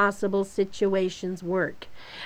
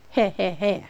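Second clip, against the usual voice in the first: snickering; 2 semitones lower than usual. Retold or imitated. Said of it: "says Beth".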